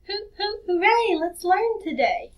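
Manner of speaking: high, bright sing-song welcome; hooting, chirpy hello to kids; cheery host greeting. Acted. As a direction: greet the little ones with delight. Host's cheery greeting song-like intro.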